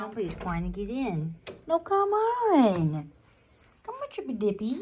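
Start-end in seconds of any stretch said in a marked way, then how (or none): 1.7-3.1 exasperated plea. Real, not acted. Someone trying to get in. 3.8-4.8 high, coaxing call to the pet; sing-song coax to a pet. Real, not acted.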